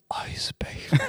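whisper. Pretend. Friend mocks the guy's reply.

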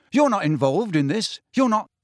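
mildly heated protest; angry. A narrator's reading. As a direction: forbid them crossly. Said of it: "said Harry angrily".

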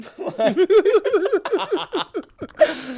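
high, loud cackling laugh; real high laughing burst; burst of laughter. Real, not acted. Guard drama retold, laughter.

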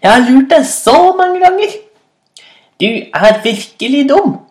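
very high, loud taunt; gloating mockery. A narrator's reading. Rabbit mocking the fooled hyena, gloating. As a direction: crow over the victim in a high pitch.

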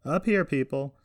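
yelled call. A narrator's reading. Carson from the upper deck to the crowd, yelling. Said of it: "Carson yell into a microphone".

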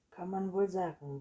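loud, grumpy narrated retort; a touch of sullen emphasis; grumbled. A narrator's reading. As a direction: grumble in agreement. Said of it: "brummte Anton".

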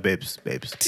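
a low, quiet babes. Retold or imitated. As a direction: keep it deep and cool. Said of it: "I was like".

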